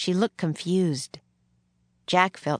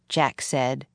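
plain reading voice

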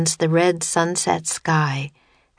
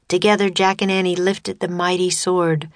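usual speaking voice

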